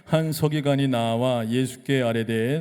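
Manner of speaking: loud, slow scripture reading with the congregation; slow loud group reading. Shown as a demonstration. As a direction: drag each phrase out so everyone reads together. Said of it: "같이 읽어볼까요? 시작".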